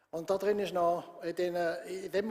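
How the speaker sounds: ordinary delivery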